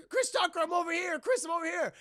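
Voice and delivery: high, strained mock call for rescue; acted-out urgent holler; calling back. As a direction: shout your location to a friend. Voices Jackie calling back.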